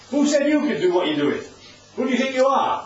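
mock indignant questioning; miffed challenge. Temple leaders confronting Jesus over his authority, miffed. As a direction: challenge him, a touch affronted. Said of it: "so they're rather miffed about it".

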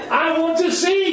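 high, loud declared request; blind man's plea. Acted. Blind man asking the Lord for sight, high urgent plea. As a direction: call it out as an urgent prayer. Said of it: "he says".

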